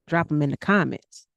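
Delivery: ordinary delivery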